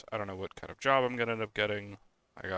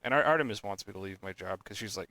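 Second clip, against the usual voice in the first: ordinary delivery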